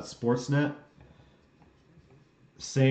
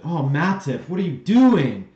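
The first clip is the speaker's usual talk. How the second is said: loud, higher cry at a player; exasperated outcry; 5 semitones higher, 8 dB louder than usual. Real, not acted. Fan yelling at Matip over a missed chance, exasperated outcry. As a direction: cry out in real dismay, louder on "doing".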